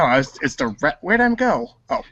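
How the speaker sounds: ordinary talk, nothing marked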